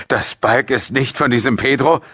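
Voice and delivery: heated father's question, pitched high; angry questioning. A narrator's reading. Father questioning his daughter about Pedro, angry suspicion. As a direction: demand it with scorn.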